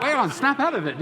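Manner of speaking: mock alarm at yourself; snapping out of it. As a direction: shake yourself awake, a touch mock. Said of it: "and I was like".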